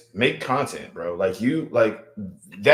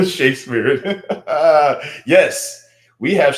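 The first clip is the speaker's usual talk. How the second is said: amused, breaking into laughter; laughing delight; 5 semitones higher, 6 dB louder, much slower than usual. Real, not acted. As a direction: laugh through it, then a loud high yes. Amused by a Shakespeare comment.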